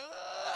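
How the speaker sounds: high straining grunt; effort grunt. Retold or imitated. Rob hacking at the bug, sharp effort grunt. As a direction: grunt with the axe swing.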